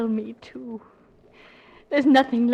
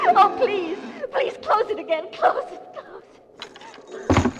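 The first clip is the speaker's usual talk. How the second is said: high, loud frightened begging; terrified pleading; 9 semitones higher, 5 dB louder, slower than usual. Acted. Daughter begging him to close the crocodile pit, terrified pleading. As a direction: beg desperately for it to be shut. Terrified girl begs at trap door.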